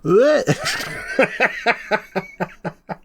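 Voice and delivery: high breaking laugh at himself. Real, not acted. YouTuber cracking up at his own Walken try, high laugh.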